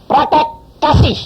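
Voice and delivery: high, loud, slow command "Praktek kasih"; voice of God. Acted. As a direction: pronounce "Praktek kasih" as a booming divine order. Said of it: "Terus Tuhan bilang gini".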